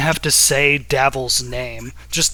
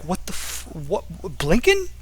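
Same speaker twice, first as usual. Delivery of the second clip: baffled; 3 semitones higher than usual. Acted. Artificer stares in confusion at disguised Blinken.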